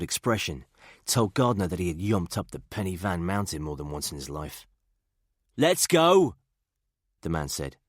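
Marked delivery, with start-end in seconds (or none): 5.6-6.4 put-on high, thick Birmingham accent; gruff Brummie soldier, higher pitch. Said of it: "He had a Brummy accent thick as old boots".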